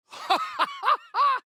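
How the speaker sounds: very high burst of laughter. Acted.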